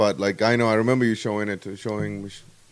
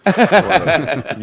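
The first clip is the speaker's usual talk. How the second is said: laughing; 7 semitones higher, 7 dB louder, much slower than usual. Real, not acted. Laughing dismissal.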